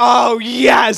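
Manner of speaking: high, loud gushing "Oh, yes"; ecstatic yes. Retold or imitated. Friend mocks his eager younger self with a loud high squeal. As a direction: squeal it like a convert who's sold. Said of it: "And you're like".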